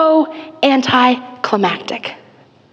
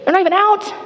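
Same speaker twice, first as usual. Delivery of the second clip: exasperated complaint; 9 semitones higher, faster than usual. Retold or imitated. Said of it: "don't talk to me about the conversation".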